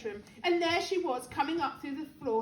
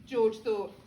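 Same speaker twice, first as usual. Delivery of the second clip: usual speaking voice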